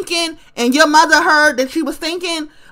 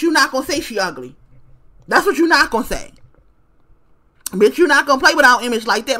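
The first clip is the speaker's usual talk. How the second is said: fast insistence; faster than usual. Real, not acted. Defending [name] emphatically.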